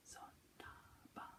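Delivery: slow whispered Zontaba; whispered echo. Shown as a demonstration. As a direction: whisper the pupil's reply. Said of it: "call and response".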